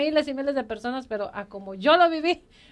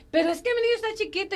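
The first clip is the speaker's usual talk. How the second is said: high, rushed exasperated objection; retold furious protest; 8 semitones higher, faster than usual. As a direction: blurt it out as a frantic, angry excuse. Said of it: "y yo estaba enojadísima".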